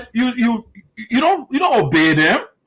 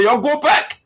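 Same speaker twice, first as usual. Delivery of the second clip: higher, curt order to go away; mock angry order; 7 semitones higher, slower than usual. Quoting the senator telling the elders to leave, angry. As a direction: mimic him brushing the elders off. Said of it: "Slabby is angry".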